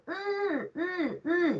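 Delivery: "uh" squeezed in the throat, each separate; short series of throaty, pressed "uh" vowels; pressing sound. Shown as a demonstration. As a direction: press each "uh" inside you. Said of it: "It's like a pressing sound".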